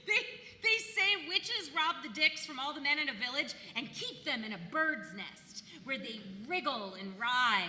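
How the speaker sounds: normal talking voice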